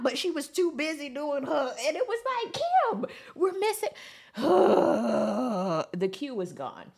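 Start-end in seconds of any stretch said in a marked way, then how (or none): 2.5-3.9 urgent stage whisper-call; high anxious call. Retold or imitated. Fan voicing panic at missed cue, high urgent call. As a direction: raise it high and loud.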